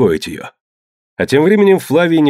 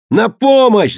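high-pitched shout for help; urgent high cry; 13 semitones higher, 4 dB louder, slower than usual. A narrator's reading. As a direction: call out for rescue, voice leaping up. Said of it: "закричал он".

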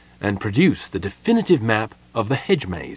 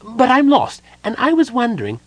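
very high, louder kender voice; polite; 13 semitones higher, 5 dB louder than usual. A narrator's reading. Lost kender asking the gnome for help, polite. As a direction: politely pipe up in a high little voice. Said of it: "said the kenda politely".

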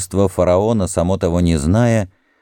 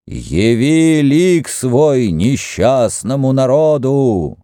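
solemn invocation; 7 semitones higher, slower than usual. Acted. Herihor calls to god.

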